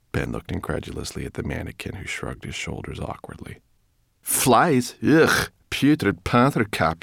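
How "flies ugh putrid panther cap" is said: high, loud disgusted sneer; disgusted brownie. Acted. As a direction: spit out the insult.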